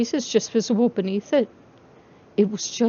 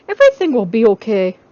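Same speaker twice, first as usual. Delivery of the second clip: louder, urgent reassurance; 7 dB louder than usual. Acted. Charlie reassuring crying babies over noise, louder. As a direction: shout comfort over the noise. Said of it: "Charlie cried".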